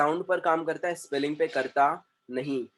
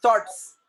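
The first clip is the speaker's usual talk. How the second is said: Thoughts as one louder, higher beat; single-syllable pronunciation; 5 semitones higher, 5 dB louder, slower than usual. Shown as a demonstration. Teacher confirming a one syllable word, single emphatic beat. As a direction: say thoughts in one go, the ending ts run together. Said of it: "one syllable word है वो".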